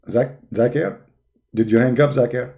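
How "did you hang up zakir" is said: ordinary talk, nothing marked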